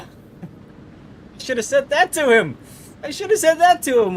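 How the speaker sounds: high, loud, slow exclamation; a high, loud groan of regret; excited regret. Real, not acted. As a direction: kick yourself out loud. Regret at a missed greeting.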